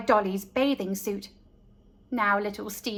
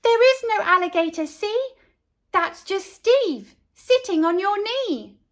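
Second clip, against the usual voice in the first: excited; 6 semitones higher, 4 dB louder than usual. A narrator's reading. Child explains to screaming mum.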